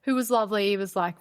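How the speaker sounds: usual speaking voice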